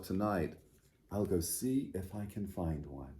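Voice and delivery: storytime read-aloud, clear and lively; children's storytime narration. A narrator's reading. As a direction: read the picture book aloud to little listeners. Reads picture book to children.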